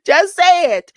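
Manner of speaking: loud, high, pushing him to say it; insistent shout. Retold or imitated. Judge urging the accused to speak up, loud insistent shout. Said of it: "He was even told".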